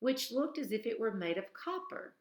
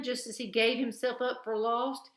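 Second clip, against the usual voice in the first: ordinary delivery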